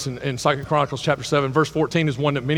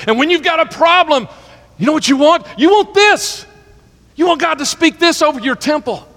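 much higher and louder, fervent preaching; preaching at full force; 10 semitones higher, 6 dB louder than usual. Acted. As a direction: lift the congregation with rising fervor.

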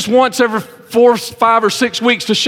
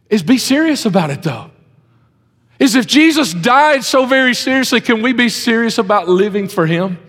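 usual speaking voice

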